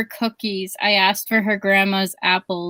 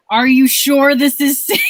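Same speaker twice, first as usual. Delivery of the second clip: mock alarm, louder and higher; alarmed passenger; 4 semitones higher, 6 dB louder than usual. Nervous passenger questioning the driver who is passing, alarmed. Voicing own panic as passenger.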